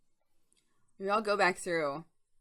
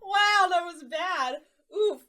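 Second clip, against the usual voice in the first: laughing; 11 semitones higher, 6 dB louder, slower than usual. Real, not acted. Reacting to own bad accent.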